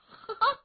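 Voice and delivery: short high sneering chuckle; a contemptuous little laugh from above; cold laugh. Acted. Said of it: "罗成一阵冷笑". (罗成居高临下的冷笑)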